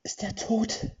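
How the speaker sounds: high, panicked question. Acted. Ludmilla the witch asks in panic whether he is dead. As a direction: ask in sudden terror. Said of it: "fragte Ludmilla panisch".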